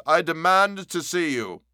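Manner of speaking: firm loud call; loud demand. A narrator's reading. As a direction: demand it loudly through the door. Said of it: "cried Utterson with a loud voice".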